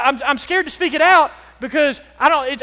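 ordinary talk

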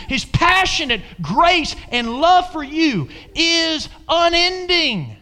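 high, building preacher's cry with drawn-out key words; full-force preaching. Real, not acted. Preacher urging the congregation on God's love, impassioned preaching. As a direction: preach it loud and high, stretching the big words.

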